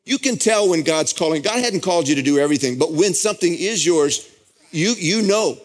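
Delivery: high, ringing sermon voice at full force; fervent pulpit delivery; impassioned preaching. Acted. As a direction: preach it, swept up in the moment.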